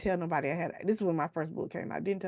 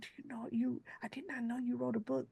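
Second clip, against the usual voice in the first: softer, high, hesitant whisper; shy whisper; 7 semitones higher, 8 dB quieter than usual. Retold or imitated. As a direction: keep the timid hushed tone. Coworker's shy whispered follow-up.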